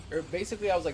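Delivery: ordinary talk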